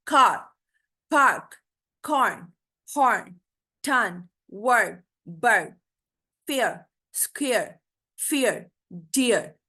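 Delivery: each word from कार to डियर with r barely sounded, falling tone; softened r. Shown as a demonstration. Teacher models r-controlled words, r not fully pronounced in कार to डियर. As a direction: blend the r into the vowel in कार, पार्क, कॉर्न through डियर. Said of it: "आर को pronounce नहीं करना".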